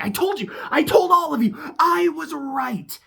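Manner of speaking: high, acted triumphant cry; vindicated outburst. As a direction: crow it high, dragging out the end. Imagined triumphant outburst from Kieran.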